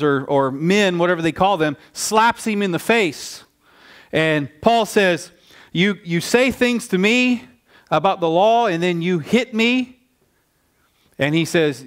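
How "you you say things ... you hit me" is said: higher, slow-paced indignant retort; acted-out affronted disbelief, pitch raised; indignant protest. Paul to the high priest after being slapped, indignant.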